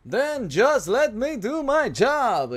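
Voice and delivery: slow, drawn-out hip-cocked Negan line; slow, smug villain drawl; Negan impression. Shown as a demonstration. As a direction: stretch it out with Negan's swagger. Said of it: "intenté copiar como el acento de él".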